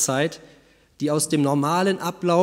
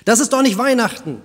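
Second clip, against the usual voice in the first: trembling voice; 5 semitones higher, 5 dB louder than usual. A narrator's reading. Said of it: "sagte er mit zitternder Stimme".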